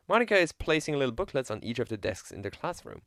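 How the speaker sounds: ordinary delivery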